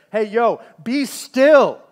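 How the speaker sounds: thunderous voice. Shown as a demonstration. Pastor voicing God's thunder at his restless church, booming. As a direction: call it out like God ending a war. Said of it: "the thunderous voice of the Lord that says".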